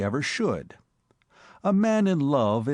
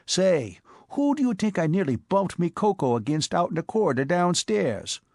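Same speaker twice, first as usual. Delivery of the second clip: higher, brash New York street voice; Bowery boy Spike; 6 semitones higher than usual. Acted. Spike breathlessly telling his boss about the cop, in a higher brash Bowery voice. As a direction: play the excitable Bowery tough.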